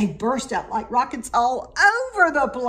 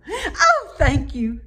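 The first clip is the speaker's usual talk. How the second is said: high, loud chirp of thanks; 5 semitones higher, 4 dB louder than usual. A narrator's reading. Gertrude thanks her uncle, high delighted chirp. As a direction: squeal it out like a delighted little bird. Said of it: "chirped Gertrude McFuzz".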